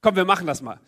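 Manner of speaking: normal talking voice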